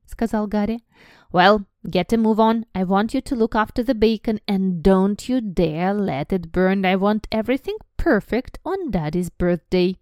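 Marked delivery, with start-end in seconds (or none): 1.3-10.0 louder, bossy aunt giving orders. Acted. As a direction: boss the boy around through the door.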